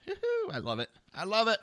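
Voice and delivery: high-pitched gleeful whoop; real giddy delight. Real, not acted. Loving the storm weather, giddy and high.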